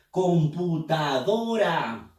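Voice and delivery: computadora drawn out slowly; slow syllabified. Shown as a demonstration. Teacher splitting computadora into syllables slowly. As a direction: stretch "Computadora" com-pu-ta-do-ra slowly. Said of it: "Vamos a silabear".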